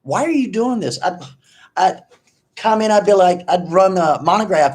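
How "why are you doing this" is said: high, pleading disbelief at a buddy; exasperated plea. Retold or imitated. Telling his buddy to stop, a high exasperated plea. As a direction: throw up your hands and ask him why. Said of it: "I told him".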